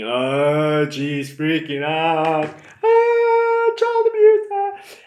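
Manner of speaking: high, loud panicky shriek; hammed-up panic, pitch shooting up; panicked. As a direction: squeal it in mock terror. Panicked thought, sure of abuse.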